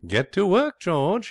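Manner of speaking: airy call across the water; breezy command. A narrator's reading. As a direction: order him about cheerfully. Mifflin orders George from the water.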